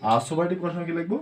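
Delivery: usual speaking voice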